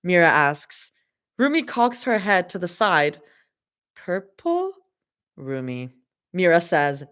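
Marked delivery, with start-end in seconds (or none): 3.9-4.7 high, rising, unsure; confused question. Acted. Rumi confused. 5.4-5.9 low, soft, flat deadpan. Acted.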